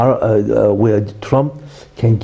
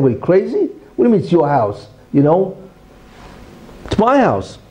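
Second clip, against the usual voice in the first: higher, indignant outburst, peaking on my; mock screaming back; 5 semitones higher than usual. Owner yelling back at the intruder, mock indignant shout. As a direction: shout back in disbelief at the claim. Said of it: "And you're screaming".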